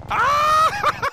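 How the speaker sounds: loud, very high scream; startled scream. Real, not acted. Player caught out in the game, real startled scream. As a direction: scream out at full pitch.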